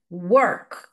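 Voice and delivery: "Work" with the W said like a Portuguese U; teacher modelling the "W" in "Work"; W sound like U. Shown as a demonstration. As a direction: round the lips on the "W" of "Work", like "u". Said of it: "Esse W tem som de U".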